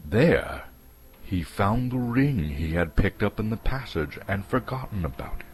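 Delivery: plain reading voice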